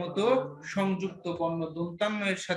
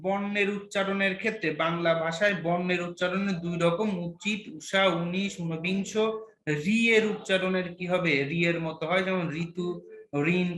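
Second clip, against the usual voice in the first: normal talking voice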